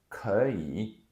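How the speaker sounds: 可以 with 可 rising in second tone, slow; 可以 said ké yǐ, slow and clear; tone sandhi. Shown as a demonstration. Teacher modeling the correct 可以 slowly. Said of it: "the 可 becomes the second tone".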